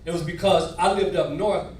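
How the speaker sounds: usual speaking voice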